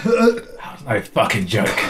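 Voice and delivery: slow, low, wrecked voice; dragging the words out, throat burned; strained, ragged voice. Real, not acted. Recovering from the sauce.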